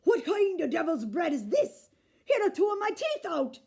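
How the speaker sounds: loud furious giant; angry shout. A narrator's reading. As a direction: roar in pain and outrage. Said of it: "shouted Cuchulainn".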